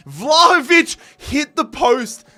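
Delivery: loud, high and slowed; disbelieving yell; excited shout. Real, not acted. Shot hits post.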